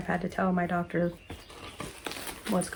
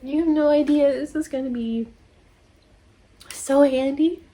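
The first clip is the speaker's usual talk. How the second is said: excited thanks; 6 semitones higher than usual. Real, not acted. Thanks for gift card.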